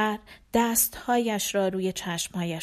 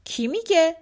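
put-on little girl voice; 8 semitones higher, 6 dB louder than usual. Girl's quoted question.

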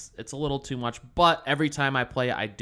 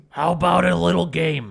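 silly character voice; 4 semitones higher, 5 dB louder than usual. Pretend. Sawdowel's fair line.

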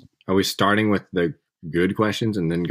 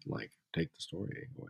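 whispered; 3 semitones lower, 19 dB quieter than usual. Real, not acted. Half-joking threat to leave.

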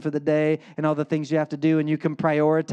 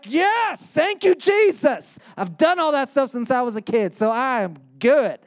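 much higher, louder self-satisfied reply; smug young man; 8 semitones higher, 4 dB louder than usual. Retold or imitated. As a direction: chirp it like you've already passed the test.

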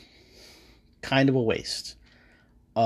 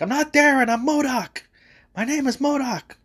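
MODOK voice; 12 semitones higher than usual. Retold or imitated. MODOK correcting Ant-Man, high and indignant. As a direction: squeal it out much higher, offended. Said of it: "and he's like".

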